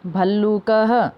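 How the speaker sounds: भल्लूकः slowly, with a sounded "ha" ending; model pronunciation. Shown as a demonstration. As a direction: draw out भल्लूकः, visarga as "ha". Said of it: "उनको भी उच्चारण करना है".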